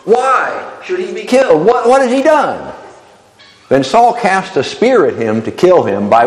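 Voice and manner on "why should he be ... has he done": higher voice for the son's pleading questions; Jonathan pleading. Acted. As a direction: plead with your father, voice lifted high. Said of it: "Jonathan answered Saul his father and said".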